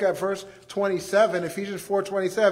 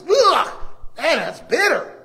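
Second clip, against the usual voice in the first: loud, high grimacing groan at a bitter taste; mock disgust at bitterness; disgusted ugh; 7 semitones higher, 6 dB louder than usual. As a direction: spit out the taste. Tasting bitter vegetable.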